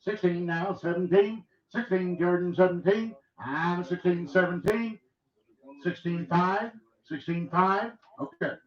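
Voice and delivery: rapid rhythmic auction chant; auctioneer. Acted. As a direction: roll the numbers like a bid caller. Auction bid calling.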